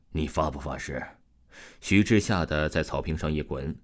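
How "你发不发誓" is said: low, softer, cold and hard threat; cold hard demand. Acted. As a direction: press him coldly for the oath. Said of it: "声音又冷又硬".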